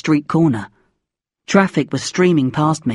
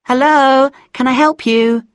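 high, polite receptionist voice; secretary; 8 semitones higher, 4 dB louder than usual. A narrator's reading. Secretary answering a caller at Dad's office, politely high. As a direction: answer the office line in a singsong lift. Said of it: "I only got a secretary".

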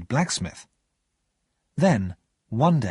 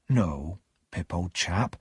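low, soft answer to a friend; gentle refusal; 5 semitones lower, 5 dB quieter, slower than usual. A narrator's reading. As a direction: turn him down gently, dropping low. Joe gently refuses Pip's invitation.

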